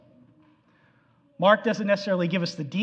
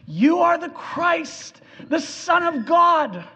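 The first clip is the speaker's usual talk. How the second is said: a lightly voiced Peter, higher and slow; Peter's confession; 9 semitones higher, slower than usual. Peter declaring to Jesus, higher slow awed voice. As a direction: proclaim it like an awed disciple, slowly.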